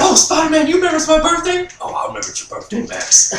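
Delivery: high mock Electro voice, then a breathy answer as Spider-Man. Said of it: "talking to a Spider-Man picture about his birthday".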